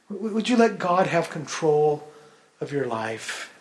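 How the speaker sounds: ordinary talk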